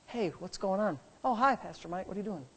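hushed, casual greeting; very quiet voice. Shown as a demonstration. Pastor greeting people in a quiet room, very quiet voice. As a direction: chat softly in a silent room.

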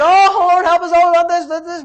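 loud, high imitation of complaining; exaggerated high-pitched begging; mock desperate plea. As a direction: wail to God in hammed-up distress. Mimics grumbling Israelites crying to God.

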